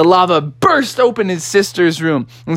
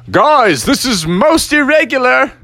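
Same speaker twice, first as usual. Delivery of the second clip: higher, swooping pompous alarm; flustered Father Bear; 7 semitones higher, 4 dB louder than usual. Acted. Father Bear clinging to umbrella, flustered higher voice. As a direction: object in a put-on, affronted dad voice.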